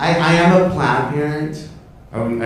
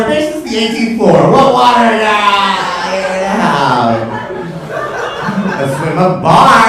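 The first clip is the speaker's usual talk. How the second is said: high, loud brush-off; loud sassy retort; 7 semitones higher, 6 dB louder, slower than usual. Acted. Realtor snaps back at a client about flooding, loud and sassy. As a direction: wave off the client's flood worry.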